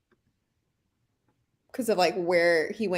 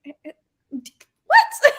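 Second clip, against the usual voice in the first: high, loud, laughing stammer into what; stammering disbelief; 7 semitones higher, 7 dB louder than usual. Retold or imitated. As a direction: sputter in amused disbelief. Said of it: "And I was like".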